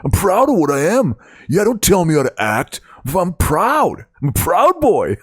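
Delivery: mock macho braggart, pitched well up; aggressive dumb guy. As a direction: strut it out like a proud meathead boasting.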